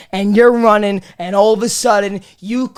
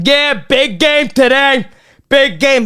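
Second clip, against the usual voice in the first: cocky, raised "big game" brag; smug jock; 4 semitones higher than usual. Retold or imitated. Lacrosse kid in the hallway bragging, cocky and raised. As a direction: strut it like a proud lacrosse kid. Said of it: "They'll be walking around like".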